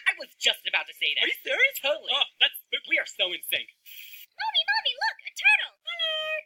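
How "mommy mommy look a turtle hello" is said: put-on high excited little kid; child voice. Small child calls to mommy about a turtle, high and eager.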